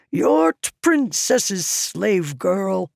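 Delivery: slow, rough country noble; gruff village accent. A narrator's reading. Tyrell dismisses the slave girl in village accent. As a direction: Tyrell speaks in his village accent, slowly.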